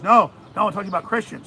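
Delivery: loud emphatic "no, no"; mild impression of an insistent president; insistent Trump. Host retelling Trump's phone call, emphatic. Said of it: "He goes".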